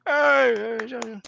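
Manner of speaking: high, loud cheer that falls away; mock crowd hooray. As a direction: greet returning heroes like a whole crowd. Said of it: "everyone's like".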